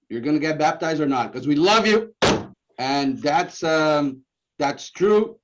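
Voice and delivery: loud, high, urgent plea; table-slamming Italian-style shout of love; fiery in-your-face ultimatum; passionate Italian outburst. Retold or imitated. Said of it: "the Italian way to say, "We love you".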